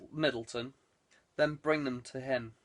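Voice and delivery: ordinary delivery, nothing marked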